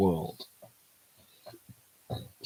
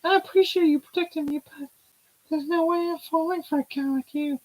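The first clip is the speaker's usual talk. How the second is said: put-on bright girlish character voice, much higher; high heroine voice; 3 dB louder than usual. Restarting the heroine's line to the guy, high girlish voice.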